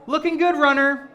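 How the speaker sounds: high-pitched mock encouragement; mocking sing-out to a stranger; passing runner's cheer. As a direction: call out a chirpy cheer, imitated. Mimics passing runners calling out.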